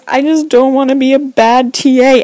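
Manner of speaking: high, loud wailing plea; mock sob of a weeping teaching assistant; imitating sobbing. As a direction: blubber it out like a crying grown man. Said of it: "he's bawling".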